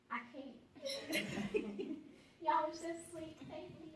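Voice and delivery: high, overcome laugh; choked-up giggle at the aunties. Real, not acted. Thanking the aunties, laughing and choked up.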